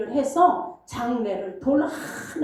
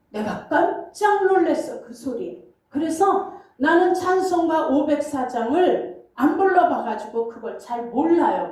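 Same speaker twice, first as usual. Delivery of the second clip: ordinary delivery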